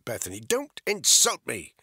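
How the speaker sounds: loud, sharp snap; armed woman snapping at the man lying to her; snapped. A narrator's reading. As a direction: bite the words off angrily. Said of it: "she snapped".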